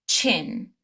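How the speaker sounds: "chin" said alone, starting on a crisp ch; suffix with ch. Shown as a demonstration. Teacher modelling the other suffix sound, clear ch. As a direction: model "chin" clearly as one short syllable. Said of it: "The shin can be pronounced".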